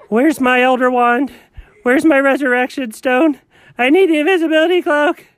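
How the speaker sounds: high, panicky cartoon fright; frightened. Scared character tells colleague about the Grim Reaper, panicking. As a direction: cry out in a rising panic.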